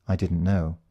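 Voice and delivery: plain reading voice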